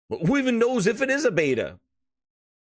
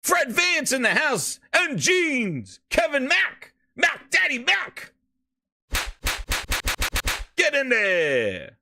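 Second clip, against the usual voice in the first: hype announcer call; 8 semitones higher, slower than usual. Real, not acted. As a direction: call them in like a ring announcer. Hype intro calling out players.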